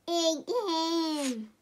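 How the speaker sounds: ordinary delivery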